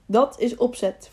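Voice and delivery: ordinary delivery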